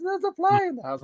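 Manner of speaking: high mock voice of the wife; lightly voiced partner, dramatic; mimicking wife. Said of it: "And she says".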